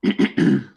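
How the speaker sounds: short preparatory throat clear; brief "ahem" before a recital. Acted. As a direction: clear your throat before reading aloud. Witness prepares to read aloud.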